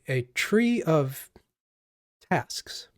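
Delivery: usual speaking voice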